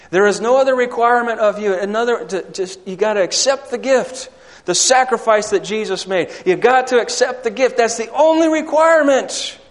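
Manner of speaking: loud, fast, high sermon climax; fiery preaching. Acted. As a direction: preach it out with full force. Preaching at full force.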